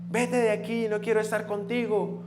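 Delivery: imitated high-pitched childish defiance; tantruming little boy. Son in a tantrum to his father, high petulant rejection. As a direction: push dad away like an angry small child.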